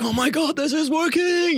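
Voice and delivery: thrilled mock squeal, way up high; retold giddy thrill. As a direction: squeal it high, amazed it's working. Retold thrill at deception working.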